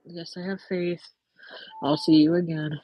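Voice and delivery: ordinary delivery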